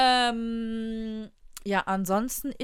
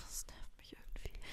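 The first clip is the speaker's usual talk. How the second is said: whispering; 21 dB quieter than usual. Real, not acted. Annoyed aside, dropping voice.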